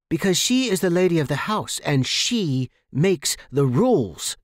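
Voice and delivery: seething, words pushed through clenched teeth; through gritted teeth. A narrator's reading. Maria rebuking Sarah across the table, loud and seething. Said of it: "she said through gritted teeth".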